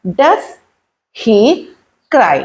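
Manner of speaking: each word alone, with long pauses. Shown as a demonstration. Teacher showing how to ask about a crying child, word by word. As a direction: slowly dictate it one word at a time.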